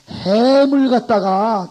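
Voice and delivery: loud acted story. Teacher acts a mnemonic scene.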